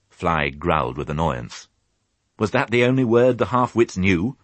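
plain reading voice

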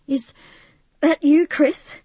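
a touch out of breath, pitched high; panting. A narrator's reading. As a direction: pant it out after a run. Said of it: "she panted".

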